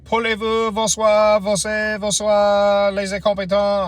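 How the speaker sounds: high, loud gibberish-French imitation; mock French speaker. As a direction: imitate a trucker rattling off French at you. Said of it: "Some truck driver stopped me".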